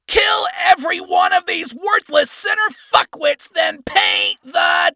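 loud, very high, drawn-out order; furious war cry. Acted. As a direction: scream the kill order. Orders the massacre.

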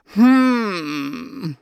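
long, high, pondering hum; hammed-up musing "hmm"; exaggerated hmm. A narrator's reading. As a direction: stretch out a theatrical thinking hum. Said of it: "did an exaggerated".

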